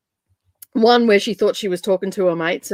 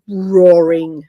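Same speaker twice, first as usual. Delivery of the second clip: drawn-out, louder roaring on the word; mock roar; 6 semitones lower, 6 dB louder than usual. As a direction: stretch it into a playful roar. Acts out her own roaring.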